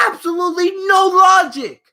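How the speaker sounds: loud exasperated outburst; fed-up shout about the booking; frustrated shout. Real, not acted. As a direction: yell it in disbelief.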